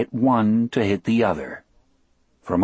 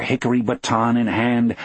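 ordinary delivery, nothing marked

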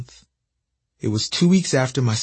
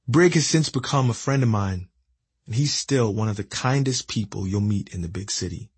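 ordinary delivery, nothing marked

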